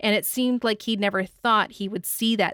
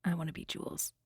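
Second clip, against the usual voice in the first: low, hushed admission; secretly confiding; 4 semitones lower, 12 dB quieter, faster than usual. Acted. Everyone's secret wish about the film character, low and hushed. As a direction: drop it soft like a private wish. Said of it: "everyone secretly is like".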